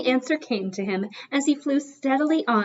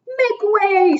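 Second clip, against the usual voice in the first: a louder, higher shout; shouting owner; 10 semitones higher, 4 dB louder than usual. Acted. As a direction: owner clears a path as he runs out, shouting. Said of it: "shouted the owner".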